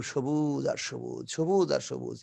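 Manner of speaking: lingering proud boast about a green village; dreamy boast. Acted. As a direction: savour each word like a proud villager. Acted boast, drawn out dreamily.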